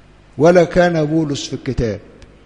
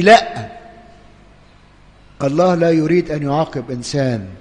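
normal talking voice

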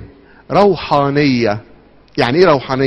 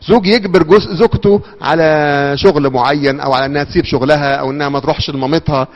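ordinary talk, nothing marked

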